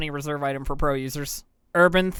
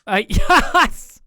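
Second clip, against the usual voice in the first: laughing; 9 semitones higher, 7 dB louder than usual. Real, not acted. Laughing at moderator joke.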